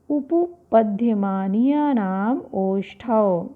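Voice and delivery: slow, measured chant of the long rule; slow sutra recitation. Shown as a demonstration. Teacher slowly reciting the lip sutra for students. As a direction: stretch each syllable of the sutra. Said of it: "इसका सूत्र है".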